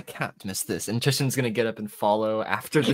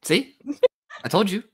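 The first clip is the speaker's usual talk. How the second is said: teasing; 9 semitones higher, 8 dB louder than usual. Acted. Teasing friend, told you so.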